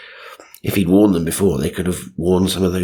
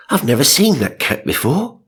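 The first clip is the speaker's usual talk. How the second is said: 1 shuddering mum; 7 semitones higher than usual. Retold or imitated. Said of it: "Mum shuddered".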